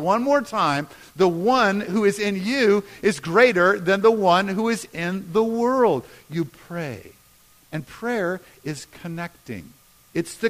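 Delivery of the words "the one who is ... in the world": loud, emphatic declaration; raised, full-voiced group reading; leading a recitation. Shown as a demonstration. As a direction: proclaim it for the room to join in. Verse repeated one more time.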